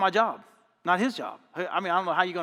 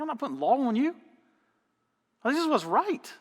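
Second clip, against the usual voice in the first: retold arguing back; 7 semitones higher than usual. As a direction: re-enact pushing back in an argument. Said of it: "we got in a huge argument".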